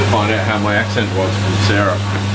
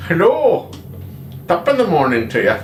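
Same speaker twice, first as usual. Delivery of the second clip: mock Irish accent; 6 semitones higher than usual. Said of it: "you do your, your best Irish".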